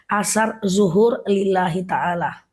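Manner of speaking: slow, deliberate recitation of the intention; measured prayer-intention recital; reciting the niat. Shown as a demonstration. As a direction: spell out each word slowly for the learners. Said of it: "gampang banget loh niatnya".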